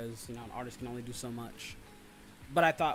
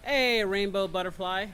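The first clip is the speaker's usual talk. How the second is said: loud, higher, drawn-out greeting; calling out; 7 semitones higher, 10 dB louder, slower than usual. Real, not acted. Host hails a chat member, calling out loudly. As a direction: call out to someone, stretching it slowly.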